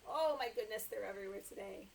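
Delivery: ordinary delivery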